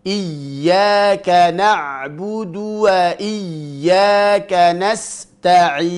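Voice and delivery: slow chant, sustaining the doubled Y of IYYAKA; flowing ya in recitation. Shown as a demonstration. Teacher showing flowing sound, slow recitation with held YY. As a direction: carry the voice through IYYAKA. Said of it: "Berjalannya suara".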